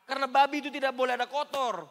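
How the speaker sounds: a touch overexcited, preaching too high; too high-pitched. Real, not acted. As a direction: push it up high and loud. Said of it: "terlalu tinggi ngomongnya".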